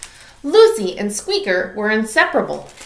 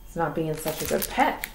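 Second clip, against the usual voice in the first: ordinary delivery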